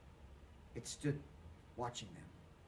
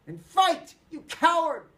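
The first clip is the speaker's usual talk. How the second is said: loud high frenzied scream; crazed fury; madman scream; 13 semitones higher, 11 dB louder, slower than usual. A narrator's reading. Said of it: "Peter screamed like a madman".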